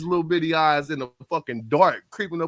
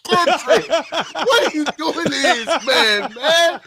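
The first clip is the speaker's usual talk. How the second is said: mock plea; 11 semitones higher than usual. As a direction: beg Kendrick in comic disbelief. Mock pleading at Kendrick.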